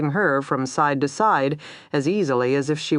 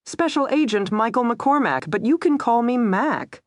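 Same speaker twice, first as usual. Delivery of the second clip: high, jaunty Southern introduction; Southern accent; 9 semitones higher than usual. A narrator's reading. Pinned agent introducing himself to his captor, Southern accent. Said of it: "with an unmistakable Southern accent".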